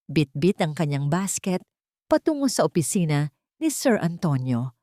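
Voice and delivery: ordinary delivery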